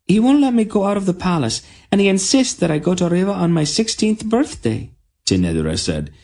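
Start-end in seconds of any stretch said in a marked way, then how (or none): 0.0-4.9 put-on high, fast, indignant girl; outraged princess. A narrator's reading. Tenedra to the queen about her father, high fast outrage. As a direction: complain in outrage. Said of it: "in an outraged tone".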